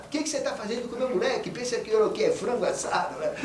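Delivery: sharp, fast and high scolding; an indignant mother catching the nanny. Retold or imitated. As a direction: tell her off for burning your boy. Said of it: "aquela bronca toda nele".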